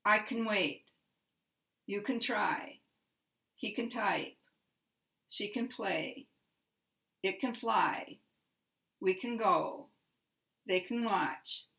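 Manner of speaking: can reduced, the last word like wait or go stressed; weak can, then land on the verb: wait, try, watch; stress on final verb. Shown as a demonstration. Teacher reading examples of the stress pattern, weak can, stressed verb. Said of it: "I will pronounce those so that you can hear the stress pattern".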